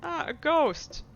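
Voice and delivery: startled blurt. A narrator's reading. Player blurts at the sight of the mother, startled. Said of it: "you blurt out".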